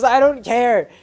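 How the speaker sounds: loud, high shout; Iggy screaming at the pilots; yelled. Retold or imitated. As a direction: shout it, done arguing.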